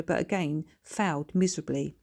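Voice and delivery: usual speaking voice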